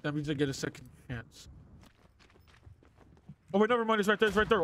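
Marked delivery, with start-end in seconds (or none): 3.5-4.6 high, loud, sudden warning; startled alarm. Real, not acted. Player suddenly seeing it right there, startled alarm. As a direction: blurt it as you spot the danger.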